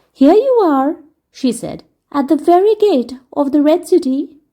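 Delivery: put-on a teasing fairy welcoming a boy; playful fairy lady. Fairy lady greeting Dick, merry and light.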